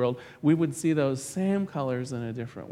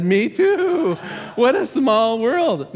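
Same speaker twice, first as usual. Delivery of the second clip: eager delight; 7 semitones higher, 7 dB louder, slower than usual. Pretend. Joke teller matching every denomination.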